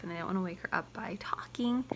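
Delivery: put-on soft whisper so the baby sleeps; hushed aside beside a sleeping baby. Shown as a demonstration. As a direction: whisper it, don't wake her. Baby asleep, avoiding waking her.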